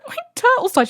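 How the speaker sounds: high, wailing cry; imitating a wail. Telling mum the turtle died, high wail. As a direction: squeal it out high. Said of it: "I was like".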